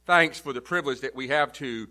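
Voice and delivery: a pastor's hushed opening prayer. Acted. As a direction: pray it quietly and reverently. Opening prayer before the sermon.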